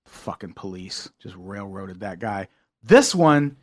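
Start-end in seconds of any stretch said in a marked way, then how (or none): 0.1-2.5 quiet, disgusted mutter. Retold or imitated. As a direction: grumble it under your breath. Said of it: "you come away from it like".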